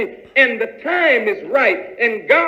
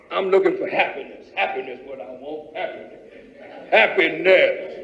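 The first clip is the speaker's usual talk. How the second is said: lower, fading longing voice; wistful seeker; 9 semitones lower, 3 dB quieter than usual. Acted. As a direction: sigh out what you long for. Acts dreamy seeker of happiness.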